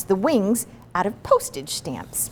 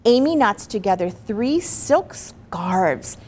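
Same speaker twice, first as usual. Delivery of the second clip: ordinary delivery, nothing marked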